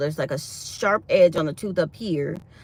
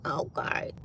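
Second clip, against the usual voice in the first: high, soft mock surprise, trailing to a whisper; 11 semitones higher, 8 dB quieter than usual. Imagining TikTok offering to pay her, high soft mock surprise. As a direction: act pleasantly shocked, then whisper the okays. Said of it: "be like".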